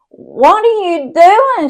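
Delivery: high, loud and slow; alarmed shout at the sister on the door; shocked yell. Retold or imitated. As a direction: yell in disbelief at what she sees.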